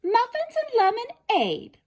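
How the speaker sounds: put-on girl voice. A narrator's reading. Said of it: "said slowly".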